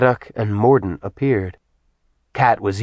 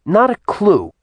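happy; 12 semitones higher, 5 dB louder than usual. A narrator's reading. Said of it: "Kish chimed in happily".

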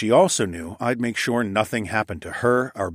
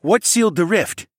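high, loud demand; pressing; 11 semitones higher, 7 dB louder than usual. A narrator's reading. King demands answer from prince, pressing. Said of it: "Tecumseh pressed".

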